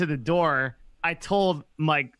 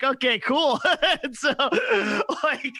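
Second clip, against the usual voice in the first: laughing through it; 11 semitones higher than usual. Retold or imitated. Son laughing as he answers his dad. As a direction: chuckle the words out high.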